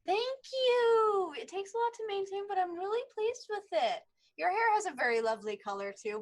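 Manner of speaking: character voice. Acted. Ionia voiced replying to gnome.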